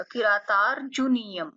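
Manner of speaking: किरातार्जुनीयम slowly, r without a vowel after ता; careful slow demo of किरातार्जुनीयम; slow model pronunciation. Shown as a demonstration. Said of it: "बिना अ के बोला जाएगा".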